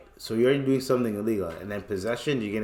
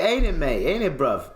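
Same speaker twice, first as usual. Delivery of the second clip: higher, swooping British slang voice; British accent; 4 semitones higher than usual. Retold or imitated. Friend chatting to police, mock British accent. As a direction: imitate a matey British tag question. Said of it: "he's just talking like that".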